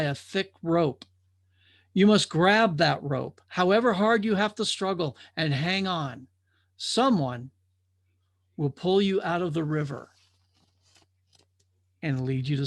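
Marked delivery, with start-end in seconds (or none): none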